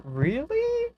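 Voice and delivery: shocked squeak of disbelief; real disbelief. Real, not acted. Streamer hears a friend got a rare drop, shocked.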